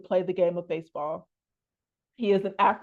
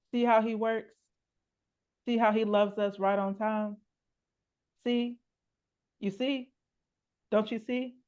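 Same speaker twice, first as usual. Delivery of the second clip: quoted Mary in a poem; 2 semitones higher than usual. Acted. Poet quotes Mary pressing her to see, lightly voiced. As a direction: lightly voice Mary, gently insistent questions. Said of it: "She said".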